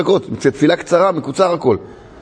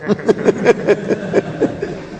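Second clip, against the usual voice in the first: high, wordless peal of laughter; real laughing fit; 5 semitones higher than usual. Real, not acted. Man sees how funny he looked, bursts out laughing. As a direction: give in to the laughter. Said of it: "התחלתי גם אני לצחוק".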